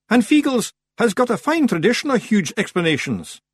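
high, loud, boastful Scottish voice; proud Rob Anybody. A narrator's reading. Rob bragging of Feegle explanations, proud. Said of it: "swelling with pride".

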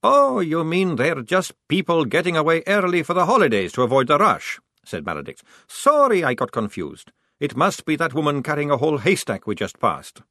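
dry, mocking sarcasm; mock them with fake innocence; sarcastic. A narrator's reading. Maledict mocking.

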